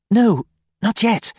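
hissed. A narrator's reading. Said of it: "she hissed".

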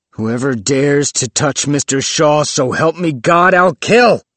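loud, high outburst building to KILL; furious threat. Acted. Willis threatens whoever would touch Mister Shaw, furious shout. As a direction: swear it in rising fury.

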